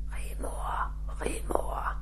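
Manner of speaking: unvoiced ghostly whisper calling a name; soft eerie spirit whisper. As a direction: breathe the name like a voice from the cabinet. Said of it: "Vi hører pludselig en stemme".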